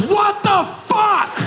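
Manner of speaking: very high, drawn-out mock scream; outraged scream. Friend sprayed in the helicopter, outraged mock scream. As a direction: scream it slowly in horrified outrage.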